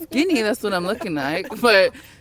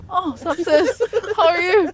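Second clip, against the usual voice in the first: high, loud imitation of a chirpy cousin; hammed-up cheerful sibling hello; mock sweet greeting; 11 semitones higher, 3 dB louder than usual. Voicing Basit's greeting.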